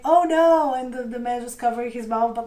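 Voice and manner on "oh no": mock dismay. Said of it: "that meme where it's like".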